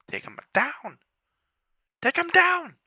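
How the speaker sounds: rising battle cry; shouting. Real, not acted. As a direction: yell it out. Battle cry during fight.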